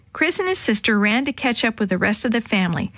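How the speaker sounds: usual speaking voice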